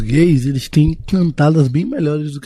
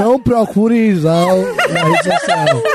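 laughing plea; 6 semitones higher, slower than usual. Real, not acted. Pleads to fans, laughing.

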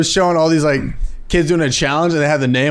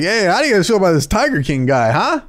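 hacky comic working the crowd; mock stand-up opener; 5 semitones higher than usual. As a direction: pitch it up to the audience, ending on a high "huh?". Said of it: "go on stage and just be like".

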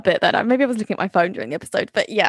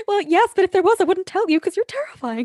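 timid high voice; 8 semitones higher than usual. Retold or imitated. Voicing timid Lily to Bart.

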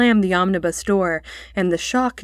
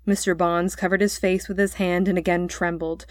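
ordinary delivery

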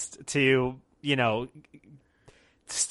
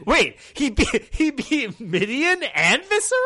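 incredulous; 11 semitones higher, 4 dB louder than usual. Real, not acted. Incredulous at the result.

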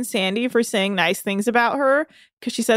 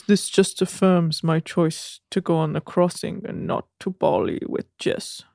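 low put-on voice sinking lower; impersonating Rob; 5 semitones lower than usual. Recapper voices castmate's travel decision, in low impersonation. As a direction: go low, dropping away at the end. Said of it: "And then he says".

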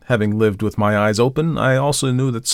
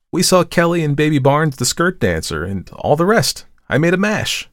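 put-on higher, bubbly young model's voice; chattering girl; 9 semitones higher, 4 dB louder than usual. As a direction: gush about last night. Tessie boasts of her mash.

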